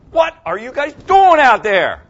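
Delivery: high, loud, slow coach's yell at the team. Retold or imitated. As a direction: bark at the players in a timeout. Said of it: "and you go".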